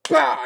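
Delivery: loud burst door-slam sound; door slam noise. Retold or imitated. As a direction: blurt out a slam.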